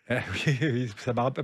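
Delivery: ordinary delivery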